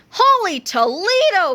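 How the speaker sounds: high, slow astonished exclamation; cried out. A narrator's reading. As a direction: draw it out in amazement. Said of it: "cried old George".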